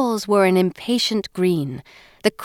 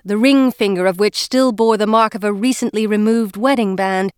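usual speaking voice

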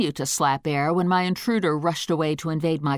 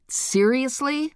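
higher, slow disbelieving question; incredulous high; 8 semitones higher, slower than usual. Acted. Cookie incredulous.

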